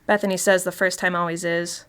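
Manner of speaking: usual speaking voice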